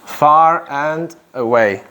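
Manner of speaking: slow, each word laid out; slow teacher model of Far and away; slow clear model. Shown as a demonstration. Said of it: "Far and away or far away?".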